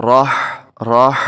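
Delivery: راہ with an audible breathy throat ha at the end. Shown as a demonstration. Teacher demonstrating the throat letter ha in راہ, breath audible. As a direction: let air rush through the throat on the ha of راہ. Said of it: "اس میں سانس کی آواز آتی ہے".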